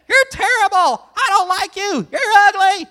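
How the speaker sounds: high, loud parrot squawk through a door; shrill parrot shouting behind a door; muffled parrot yelling. Acted. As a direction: yell insults from inside the freezer. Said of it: "still talking from inside the fridge".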